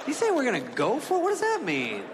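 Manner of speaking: high, anxious questioning, pitched well up; panicky high voice. Retold or imitated. Comic asking his buddy about the landing, panicky and high. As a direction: sound like you're scared to be on board.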